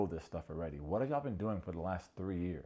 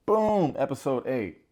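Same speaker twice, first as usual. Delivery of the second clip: hype shout; 4 semitones higher, 9 dB louder, slower than usual. Real, not acted. Hype episode transition.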